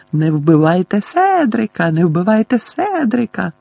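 high, whiny mimicry of pleading; piercing mock squeal. A narrator's reading. Boy mimicking cousin's nightmare pleas, piercing squeal. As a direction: squeal it in mocking imitation. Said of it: "пропищав пронизливим голосом".

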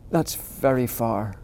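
slow, drowsy musing; sleepy thought. Acted. Little hare nearly asleep, slow drowsy thought. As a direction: let sleep creep into it. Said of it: "thought Little Nut Brown Hare".

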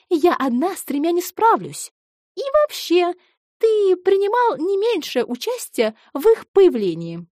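high, loud indignant teasing; mock outrage. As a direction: huff at him in jest. Жена шутливо возмущается. (шутливое возмущение)

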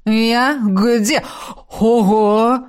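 groggy spirit caught off guard; startled gasp. Acted. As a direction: exclaim in surprise. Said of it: "охнул Рогнус". (удивлённо ахнув)

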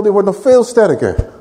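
normal talking voice

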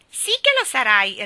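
loud, high teasing repeat; mocking echo. A narrator's reading. Geraldine repeats Ivy's words, mocking. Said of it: "ripeté Geraldine".

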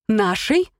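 «Нашей?» rising sharply in pitch at the end; «Нашей?» as a questioning echo with a high rise; pointed echo question. A narrator's reading. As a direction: pick up «Нашей?» as a challenge, voice lifting. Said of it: "зацепилась мама за это слово".

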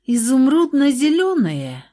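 drawn-out, reluctant question; reluctant drawl. A narrator's reading. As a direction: stretch it out unwillingly. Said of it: "с неохотой протянула Луиза".

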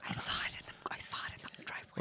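quick hushed whisper; fast whisper. Acted. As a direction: whisper it fast so the master can't hear. Interviewer answers in a secret aside.

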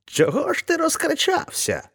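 put-on a sly old man still hiding his voice; same disguised voice. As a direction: keep up the fake stranger. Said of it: "промовив він тим же голосом".

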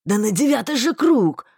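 loud, urgent reply; agitated answer. A narrator's reading. Marston answering the heroine, agitated. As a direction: blurt it out. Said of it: "ответил Марстон".

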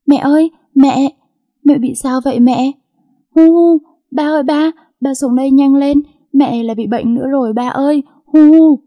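put-on high, loud child's panicked cry; crying out loudly. A narrator's reading. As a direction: wail for help with acted sobs. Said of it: "nó liền kêu to". (kêu to hốt hoảng, mếu máo)

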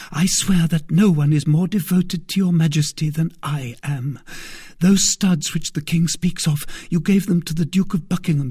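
put-on high, urgent young woman voice; fervent young maid. A narrator's reading. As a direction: swear loyalty with urgency. Maid swearing loyalty.

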